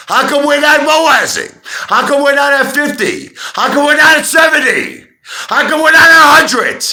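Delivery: high, loud exasperated yelling at the viewers; angry shouting. Real, not acted. Ranting at followers about the stock price, angry high shout. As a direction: blow up at them, pitch climbing with each question. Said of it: "I'm sorry that I'm getting angry".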